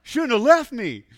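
high, loud, drawn-out accusation; acted angry yell. Lost hiker blaming the friend who left him, angry yell. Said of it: "He's mad at me".

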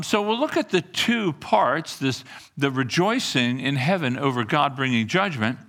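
usual speaking voice

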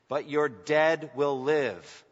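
slow, deliberate scripture reading, each word weighed; read slowly. Shown as a demonstration. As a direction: lay out every word slowly, letting "dead" land. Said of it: "I'll read it slowly".

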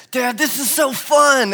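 put-on high, loud, slow child's delight; retelling the son calling to Dad in the school hallway, high and excited; excited little son. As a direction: gush like a thrilled small boy.

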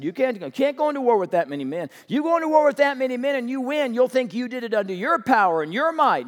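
loud, fast insistent warning; God warning Gideon loudly against pride; emphatic warning. Acted. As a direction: lay down the law firmly. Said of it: "God said".

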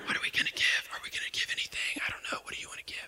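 hushed whisper between spouses. Retold or imitated. Couple quietly wondering what to give, whispering. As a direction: whisper it like a private worry.

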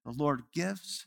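put-on soft, hushed quote in a light German accent; a touch of German accent, quiet and reverent. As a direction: quote the widow gently with a hint of German. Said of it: "in her thick accent".

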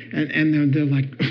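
usual speaking voice

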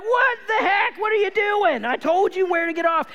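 high, loud mock telling-off; exasperated scolding. Dad to son after a mild heart attack, exasperated. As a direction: act out a dad losing his cool.

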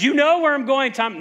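Jesus assuring a doubting disciple, confident. Retold or imitated. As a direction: state it with full confidence. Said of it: "Jesus so confidently says".

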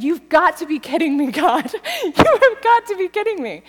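loud, laughing disbelief; laughing, incredulous. Retold or imitated. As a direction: laugh it out at God. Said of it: "I started to laugh".

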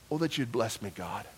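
soft prayer. Acted.

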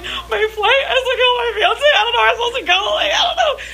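very high, squeaky blubbering; imitating your own sobbing panic; mock hysterical crying. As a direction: wail it like a comic meltdown. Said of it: "crying hysterically".

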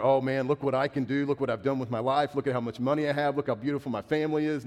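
loud, bragging voice, a bit fast; mock boasting. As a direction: show off like a proud man. Boastful imagined self-talk quoted.

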